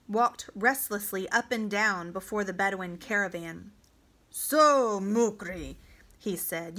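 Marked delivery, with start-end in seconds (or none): 4.3-5.8 loud, drawn-out address to a captive; imperious commander's voice; commanding officer. Acted. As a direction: call him out with a conqueror's authority. Victorious commander addresses his captives.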